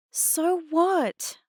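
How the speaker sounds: high-pitched defiant comeback; defiant retort. A narrator's reading. Girl talking back to Mum, defiant. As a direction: throw it back at her.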